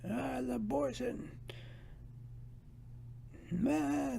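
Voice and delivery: high dismissive mock church-lady voice; mock a prim lady shrugging it off, high; squeaky mock church lady going meh.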